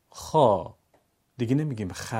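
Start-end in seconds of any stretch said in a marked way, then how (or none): 0.1-0.7 خا with a full, round, long open aa, louder; heavy long alef. Shown as a demonstration. Teacher showing pupils the heavy alef, loud and drawn out. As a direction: open the mouth tall and stretch خا. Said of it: "ببینید".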